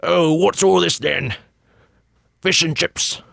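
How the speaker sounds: high, hammed-up British accent; a cartoon Englishman pulling up to a porch; jokey British bobby voice; mock British accent. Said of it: "that's not even remotely what he said".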